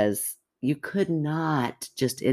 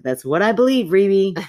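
louder, higher, insisting on her belief; emphatic, half-laughing insistence; 6 semitones higher, 5 dB louder than usual. Real, not acted. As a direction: press the point home to her friend. Insisting to co-host.

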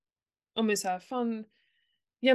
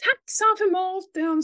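higher, slow, mock-stern; mock scolding; 8 semitones higher, 4 dB louder, slower than usual. Mother voicing a scolding she held back, slow mock stern. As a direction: scold each word out. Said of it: "så där vill jag liksom".

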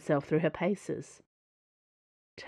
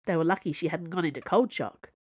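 usual speaking voice

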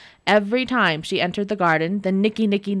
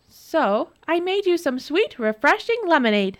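sugary sweet; 8 semitones higher than usual. Acted. Nora sugary.

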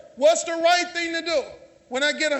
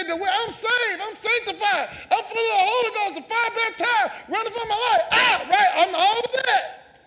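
mocking churchgoer; 5 semitones higher than usual. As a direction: ham up the saved-and-sanctified church member. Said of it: "They say".

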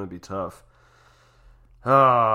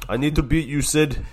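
higher, louder determined vow; Ovechkin taunt; 7 semitones higher, 9 dB louder than usual. Retold or imitated. Ovechkin vowing to beat Crosby, higher and louder. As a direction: push the line at Sid. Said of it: "Even Ovechkin was like".

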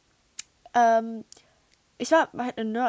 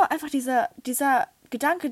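normal talking voice

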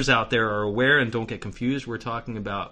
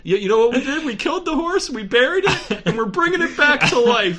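much higher, laughing and excited; 14 semitones higher, 7 dB louder than usual. Real, not acted. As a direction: squeal it out through laughter. Excited joke, squealing laugh.

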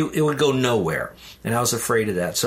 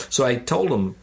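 normal talking voice

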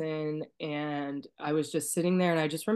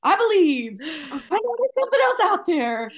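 much higher and louder, a burst of conviction; sudden belief; 14 semitones higher, 8 dB louder than usual. Retold or imitated. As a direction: exclaim it like a revelation. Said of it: "I was like".

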